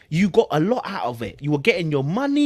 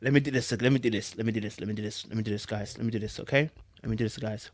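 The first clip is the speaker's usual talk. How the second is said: softer, steadying himself to be serious; calming down before reading; quiet let me do this, pulling himself together; composing himself; 7 semitones lower, 7 dB quieter, faster than usual. Real, not acted. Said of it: "I'm gonna try and be serious".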